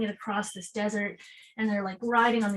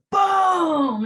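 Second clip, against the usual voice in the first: a shouted, much higher, much louder "boom"; mock explosion; 7 semitones higher, 8 dB louder than usual. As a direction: burst out the boom like a blast wave. Climax of the energy wave.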